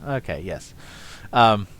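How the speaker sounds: ordinary talk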